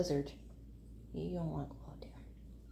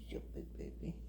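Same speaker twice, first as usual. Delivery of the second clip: fond whisper; 12 dB quieter than usual. Real, not acted. Owner whispering fondly to her big baby cat. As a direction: breathe it to the cat like a secret.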